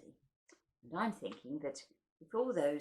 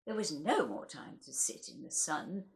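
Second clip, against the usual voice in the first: wistful, lilting recital with higher lines; poem recital; 9 semitones higher, 5 dB louder than usual. Acted. Reading Joyce Grenfell's Time to listeners, wistful recital. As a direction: let the memories swell then fade.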